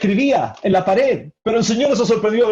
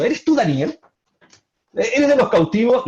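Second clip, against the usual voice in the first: higher-pitched contemptuous questioning; 5 semitones higher than usual. Acted. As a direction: question him with a king's scorn. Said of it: "La expresión es con desprecio". (con desprecio, en tono más agudo)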